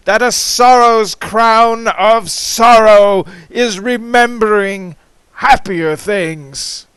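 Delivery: impassioned declamation. Acted. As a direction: proclaim the grief aloud. Impassioned climax of the poem.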